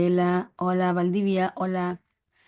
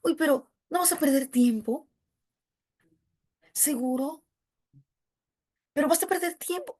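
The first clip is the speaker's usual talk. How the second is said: doubting relative; 7 semitones higher, faster than usual. Retold or imitated. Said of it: "Y te dicen".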